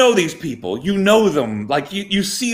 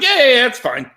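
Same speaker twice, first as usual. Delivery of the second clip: breezy brush-off; 7 semitones higher, 4 dB louder than usual. Retold or imitated. Boss brushing it off again.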